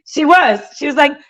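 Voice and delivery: ordinary delivery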